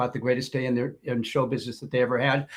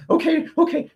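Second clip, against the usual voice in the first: high, nervous double okay; flustered waitress; 14 semitones higher, 4 dB louder than usual. Retold or imitated. Waitress taking the manager's orders, high and flustered. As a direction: squeak out an anxious okay. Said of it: "She's like".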